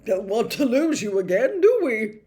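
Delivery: hearty laugh. A narrator's reading. Minister joking to Harry with a hearty laugh. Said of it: "hearty laugh".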